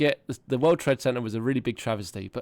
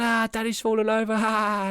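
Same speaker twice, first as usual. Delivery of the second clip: high-pitched child mocking his dad; gleeful kid imitation; 10 semitones higher than usual. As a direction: mimic a kid laughing at dad. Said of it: "He's sitting going".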